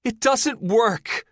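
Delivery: high, loud, drawn-out answer; trapped woman shouts her answer up the shaft; woman yelling back. A narrator's reading. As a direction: yell back slowly, frustrated. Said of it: "she yells back".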